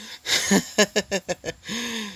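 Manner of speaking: high eerie cackle from a severed head; severed head laughing. Acted. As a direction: let the cut-off head cackle, high and creepy. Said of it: "Flaros' severed head starts laughing".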